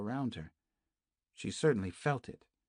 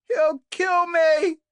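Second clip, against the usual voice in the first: much higher and louder, slow drawn-out yell; terrified shout; 12 dB louder, much slower than usual. A narrator's reading. Kate shouts back at Naomi, terrified yell. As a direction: shout it in terror. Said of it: "shouted Kate".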